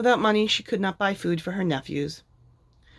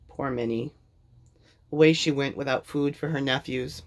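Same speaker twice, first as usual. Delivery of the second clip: plain reading voice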